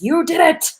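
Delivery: booming mock proclamation from the heavens, high and loud; hammed-up voice of God declaring victory; God announcing. Coach jokes about God announcing your transformation, mock booming. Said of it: "God himself is going to announce".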